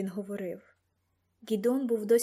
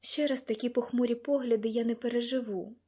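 usual speaking voice

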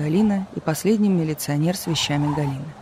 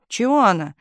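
higher-pitched aggrieved protest; crying out; 5 semitones higher than usual. A narrator's reading. Telegraph operator still protesting to chief, crying out. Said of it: "кричала она".

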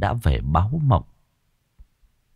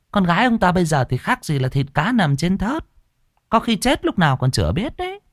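higher-pitched village busybody voice; second villager butting in, pitched up; gossiping villager chiming in; 7 semitones higher than usual. Acted. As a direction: gossip in a raised, high pitch. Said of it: "Người khác lại chen vào".

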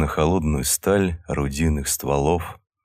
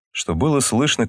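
usual speaking voice

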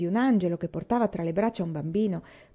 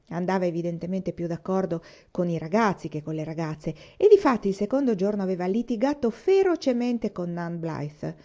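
usual speaking voice

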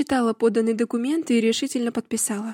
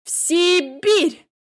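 slow, loud, higher menacing repeat; 7 semitones higher, 6 dB louder, much slower than usual. A narrator's reading. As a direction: let it ring like a sentence. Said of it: "грозно повторила я". (грозно)

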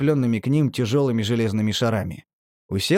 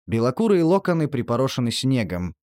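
usual speaking voice

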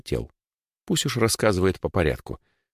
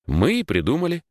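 higher, offhand answer; offhand shrug; 9 semitones higher than usual. A narrator's reading. Эдвард answers who invented the name, shrugging it off. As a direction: toss it off as self-evident. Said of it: "пожал плечами Эдвард".

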